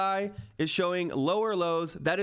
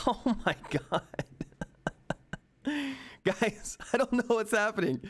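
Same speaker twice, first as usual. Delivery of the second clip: giddy laughing; 4 semitones higher than usual. Real, not acted. Stock price jumping live on stream.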